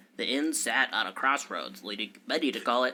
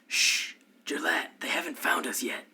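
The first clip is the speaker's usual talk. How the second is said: whisper; 5 semitones higher than usual. Acted. DM warns in a hushed tone.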